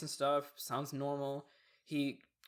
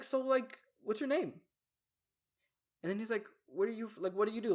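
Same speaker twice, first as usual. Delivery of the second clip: raised pitch, friendly eager questions; sociable classmate; 7 semitones higher than usual. Retold or imitated. Classmate getting friendly after class, high and eager. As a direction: imitate a suddenly chatty guy, lifting up high. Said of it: "super nice and super sociable".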